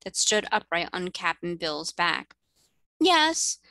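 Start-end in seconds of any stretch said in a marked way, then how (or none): 3.0-3.6 lightly voiced queen, a touch higher and kindly; a hint of a gracious mermaid queen. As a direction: explain like a gentle queen of the sea. Queen explains fins.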